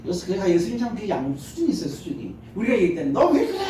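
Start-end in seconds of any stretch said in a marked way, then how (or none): 3.1-3.6 high, laughing mock snap; mock snappy retort. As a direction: imitate a rude comeback, half-joking. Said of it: "이럴 수 있잖아요". (반쯤 웃으며 따지듯이)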